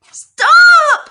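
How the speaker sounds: shrill piercing shout, very high and loud; terrified pig screaming stop. A narrator's reading. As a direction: scream it at the top of your lungs. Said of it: "screamed Wilbur".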